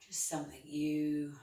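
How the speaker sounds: soft, unhurried guiding voice; soothing guided relaxation. Acted. Yoga teacher calming her class, in a soft guiding voice. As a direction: settle the class into stillness.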